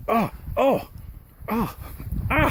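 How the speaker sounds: short high yelps of pain; quick "oh, ah" from scorched feet. Real, not acted. Hiker on burning hot sand, pained yelps.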